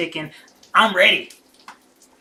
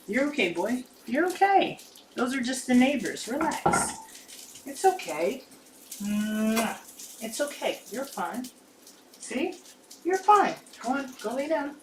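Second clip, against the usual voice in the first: soothing the dog; 6 semitones higher than usual. Real, not acted. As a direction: coo him down gently.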